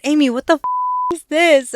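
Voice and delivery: loud, high, startled exclaim; shocked outburst. Retold or imitated. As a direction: blurt it out in disbelief. Boyfriend's shocked reaction retold.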